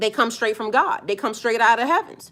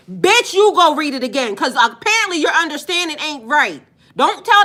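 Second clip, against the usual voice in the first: louder, higher furious retort; angry comeback; 4 semitones higher, 6 dB louder than usual. Real, not acted. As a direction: throw the words right back at him.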